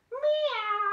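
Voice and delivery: high, drawn-out meow; high mewing that slides down; cat meow. Pretend. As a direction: imitate a cat for children. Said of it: "she makes a noise like this".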